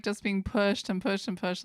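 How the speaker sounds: usual speaking voice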